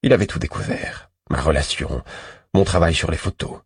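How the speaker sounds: ordinary delivery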